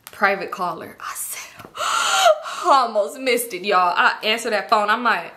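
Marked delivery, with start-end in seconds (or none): 1.7-2.6 sudden high gasp; sharp startled gasp. Real, not acted. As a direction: gasp as if caught off guard. Phone rings, almost missed.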